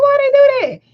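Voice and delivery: judgmental disbelief. Retold or imitated. Onlooker questioning the child's leash, incredulous. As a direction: retell it as a scandalized little query. Retold judging reaction.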